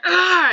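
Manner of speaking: high, loud strangling cry; mock angry yell. Five-year-old lunging to strangle the storyteller, mock angry yell. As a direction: imitate a furious little kid's roar. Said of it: "gets so angry and starts".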